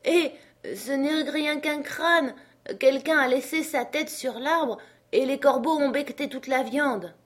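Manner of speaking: high character voice; servant up a tree. Acted. Jupiter reports the skull to his master, wondering.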